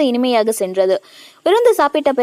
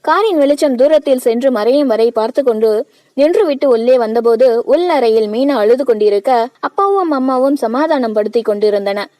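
ordinary delivery

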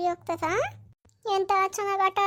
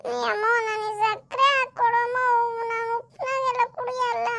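high put-on little child voice; whining child; 5 semitones higher than usual. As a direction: whine at mother like a fussy kid. Child voiced, refusing salty drink. (ಹಠ ಮಾಡುವ ಮಗುವಿನ ಧ್ವನಿ)

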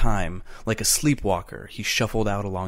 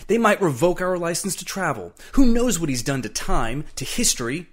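agitated, higher-pitched tirade; angry rant; 6 semitones higher than usual. A narrator's reading. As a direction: rail at the fool who ruined everything. Travis rants at Eccles's mistake.

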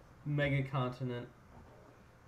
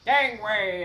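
loud, higher pirate call; 5 semitones higher, 9 dB louder than usual. Pretend. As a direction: shout it like a deckhand. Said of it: "how do I do a pirate voice like that".

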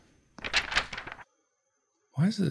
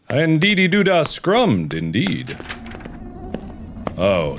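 normal talking voice

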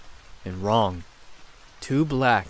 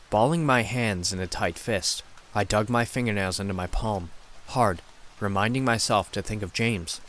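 ordinary delivery, nothing marked

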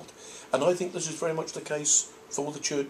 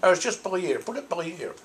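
Cardiff bloke; 5 semitones higher, 3 dB louder, faster than usual. Retold or imitated. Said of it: "the bloke was saying".

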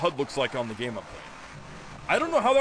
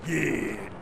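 attempted game-character cheer; Donkey Kong impression; 3 semitones lower than usual. Streamer retrying the impression, drawn-out character voice. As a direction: stretch it in a put-on ape voice. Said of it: "How did I do it?".

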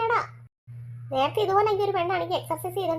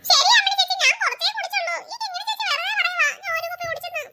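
put-on high boy voice denying and complaining; a kid protesting he's blamed unfairly; little boy defending himself to his mother; indignant little boy; 10 semitones higher, 3 dB louder than usual.

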